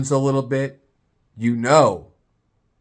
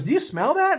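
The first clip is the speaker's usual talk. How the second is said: woman's voice. Retold or imitated. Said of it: "She goes".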